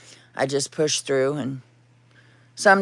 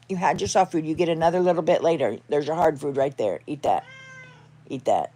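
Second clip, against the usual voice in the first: ordinary talk, nothing marked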